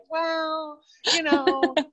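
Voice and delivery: high, hesitant little voice; timid. Retold or imitated. Ron imitating his timid younger self, high and unsure. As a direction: shrink back like a shy newcomer. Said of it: "both of us really timid and being like".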